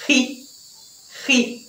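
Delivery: "Ri" with a raspy back-of-throat h; throaty r sound. Shown as a demonstration. Teacher isolating the Spanish g sound "Ri". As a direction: rasp the "Ri" like a breathy Portuguese r. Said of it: "som mais parecido com o nosso R".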